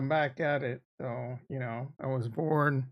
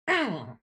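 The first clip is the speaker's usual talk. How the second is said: throat clearing; 3 semitones higher than usual. Real, not acted. Voice issues stream.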